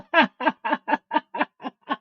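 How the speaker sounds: rattling, drawn-out laugh. Real, not acted. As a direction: laugh in a long run of short bursts. Laughs alone before show name.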